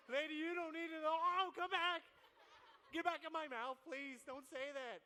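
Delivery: high, loud panicked rush of words; loud mock blurt. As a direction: blurt it out then scramble to take it back. Retelling his blurt to a customer.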